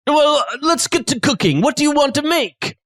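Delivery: high-pitched chef character; hospitable chef. Acted. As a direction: invite the guest to cook, voice lifted. Chef invites guest to cook.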